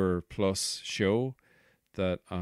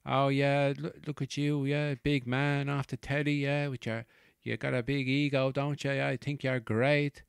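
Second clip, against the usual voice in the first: higher, mocking North Side Dublin accent; 6 semitones higher than usual. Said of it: "she did kind of a North Side accent".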